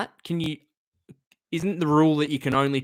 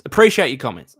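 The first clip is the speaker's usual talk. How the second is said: louder, emphatic thanks with a falling end; 8 dB louder than usual. Real, not acted. Streamer thanking a viewer's comment, said loud. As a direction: call the thanks out to the chat.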